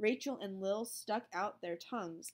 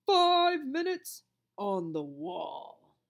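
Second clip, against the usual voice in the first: slow, loud stern command; stern monitor; 8 dB louder, much slower than usual. A narrator's reading. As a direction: lay down the punishment slowly. Monitor voice punishing.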